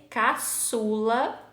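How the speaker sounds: "caçula" drawn out slowly with Ç as S; teacher sounding out "caçula" in slow syllables; little S sound. Shown as a demonstration. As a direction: stretch "caçula" to bring out the S. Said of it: "ó o sonzinho de S".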